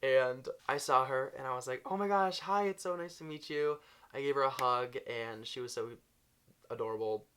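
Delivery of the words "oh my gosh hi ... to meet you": gushing excitedly. Retold or imitated. Meeting her idol, gushing.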